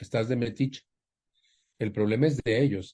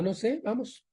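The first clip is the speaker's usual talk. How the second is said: mother's voice; 12 semitones higher, 3 dB quieter than usual. Retold or imitated. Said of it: "Y me dijo mi mamá".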